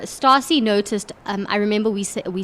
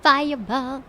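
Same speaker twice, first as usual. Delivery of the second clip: lightly put-on, drawn-out spell call; a touch higher and softer, playful pop-diva firebolt; 3 semitones higher, 4 dB quieter, slower than usual. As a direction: cast it in a silly, unserious voice. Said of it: "but not in that voice".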